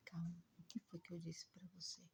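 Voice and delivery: hushed near-whisper, soothing; calm him down in a whisper; soft hush. Retold or imitated. Medium soothing the grieving spirit in a hushed whisper.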